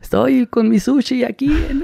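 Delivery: high, slow mocking voice of a youtuber showing off food; mock a youtuber narrating his sushi; mock influencer post. Paparazzo mocking youtubers who post everything, high and slow. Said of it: "porque son bien burros".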